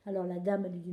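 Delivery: ordinary delivery, nothing marked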